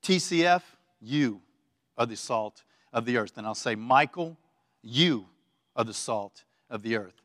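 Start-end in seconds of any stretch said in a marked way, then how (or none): 0.0-3.4 name, pause, then a lower "you" set apart; direct address. Shown as a demonstration. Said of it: "I'll say it like that to you". 3.8-7.2 solemn personal declaration, "you" set apart; direct address. Shown as a demonstration. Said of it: "I'll say it like that to you".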